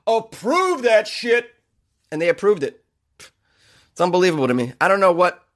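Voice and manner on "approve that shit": slow, offhand mock approval. Board members waving the troll through, slow and offhand. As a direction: drag out the approval like it is nothing.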